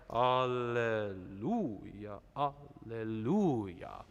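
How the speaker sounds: alleluia slow with heavy weight on lu; over-exaggerated stress in alleluia; exaggerated syllable stress. Shown as a demonstration. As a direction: lean hard on the lu of alleluia, drawn out. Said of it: "That's an over-exaggeration".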